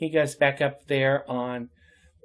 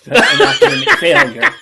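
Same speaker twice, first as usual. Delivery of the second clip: loud, high burst of real laughter through the words; laughing admission; 15 dB louder than usual. Real, not acted. Speaker owning up to bad college grades, laughing hard. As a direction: crack up while confessing.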